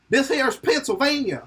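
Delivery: high, twangy Pennsylvania yokel voice, hammed-up; Pennsylvania accent. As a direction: play a Pennsylvania local with a put-on accent. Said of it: "how people in Pennsylvania sound".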